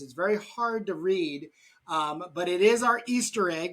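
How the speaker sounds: ordinary talk, nothing marked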